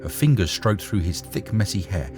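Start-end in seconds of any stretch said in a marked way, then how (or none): none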